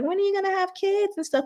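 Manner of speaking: high, sing-song prying church voice; mock church member. Church member prying about kids, high mocking voice. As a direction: needle her about having kids.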